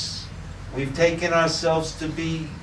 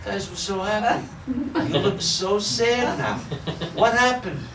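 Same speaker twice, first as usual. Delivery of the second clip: higher, laughing boatman voice; amused boatman; 8 semitones higher than usual. Retold or imitated. Boatman questioning the crying monks, high and amused. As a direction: quizz them with barely held laughter.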